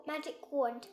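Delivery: plain reading voice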